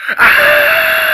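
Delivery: sudden loud high scream; scary shout. Acted. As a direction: jump out and scream. Scaring Calvin.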